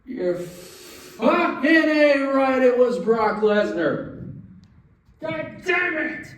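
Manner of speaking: high, slow furious rant; exasperated fan yelling at WWE; mock fury. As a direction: fume at the booking, hammed up. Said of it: "Sorry for storming out again".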